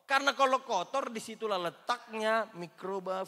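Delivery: a touch overexcited, preaching too high; too high-pitched. Real, not acted. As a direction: push it up high and loud. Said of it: "terlalu tinggi ngomongnya".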